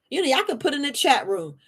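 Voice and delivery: usual speaking voice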